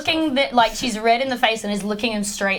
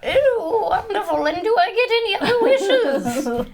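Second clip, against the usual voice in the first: high character voice; 10 semitones higher than usual. Acted. Player answers in character.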